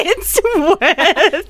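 drawn out slowly through laughter; high, laughing stretched-out words. Real, not acted. Laughing along at the joke.

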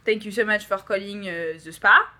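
hesitant French-accented receptionist; put-on French accent, feeling for words. Shown as a demonstration. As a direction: greet callers in a halting French accent. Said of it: "then I did the French accent".

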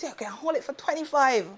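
high-pitched imitation of a street hustler; slurring street guy. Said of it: "this guy is slurring his words".

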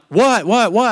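high, rising what what what; frantic what. Retold or imitated. Husband fearing a death on the phone call, frantic high questions. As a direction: demand the news in a panic. Panicked husband demands news.

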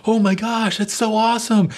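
very high, loud mock squeal; squealing girl. Girl to her parents, squealing over the car. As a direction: shriek with teen delight. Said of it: "She's like".

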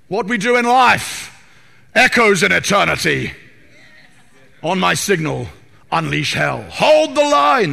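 rally the troops before the charge; Gladiator battle speech. Acted. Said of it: "that's from Gladiator".

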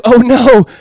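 loud, high exaggerated "oh no"; mock dismay. Preacher voicing disciples who forgot the bread, hammed-up dismay. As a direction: cry out in playful alarm.

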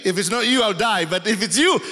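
half-laughing Peter bargaining; a touch of excited Peter; lightly eager Peter. Retold or imitated. Peter's logic acted.

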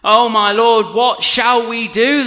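loud, high plea in narrated panic; panicked servant. A narrator's reading. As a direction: cry out for help as the frightened servant. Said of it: "can you imagine this panic".

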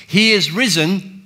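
angel's proclamation. Retold or imitated. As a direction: announce it triumphantly. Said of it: "the angel says to them".

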